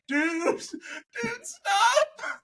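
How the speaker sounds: rising, high desperate hiss of dude; high urgent plea to his buddy; panicked plea. Retold or imitated. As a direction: beg a friend to stop, alarmed.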